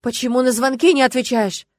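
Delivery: loud, high frantic demand; desperate shout. A narrator's reading. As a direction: demand an answer, frantic with worry. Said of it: "отчаянно закричал он".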